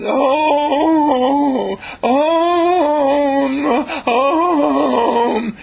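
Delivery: high, loud, drawn-out moan of a worried mother. Acted. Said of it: "and she's moaning".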